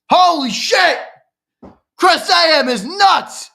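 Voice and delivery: high, loud, drawn-out disbelief; shocked exclamation. Real, not acted. Streamer stunned by a hundred dollar super chat, loud shocked shout. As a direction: blurt it out, stunned by the donation.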